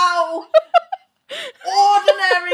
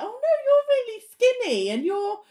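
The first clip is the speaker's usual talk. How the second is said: mock flattery; 7 semitones higher, faster than usual. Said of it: "bullshit them and be like".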